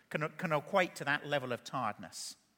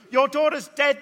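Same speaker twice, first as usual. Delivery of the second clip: much louder and higher; blunt announcement; 8 semitones higher, 11 dB louder than usual. Acted. Men from the house telling Jairus, loud and blunt. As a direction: call out the bad news. Messengers break news to Jairus.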